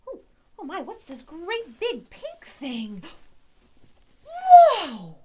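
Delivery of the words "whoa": high loud gasp of wonder; amazed whoa. Acted. Teacher showing a pink flamingo, high amazed whoa. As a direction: sell the big surprise. Pulling a surprise from bag.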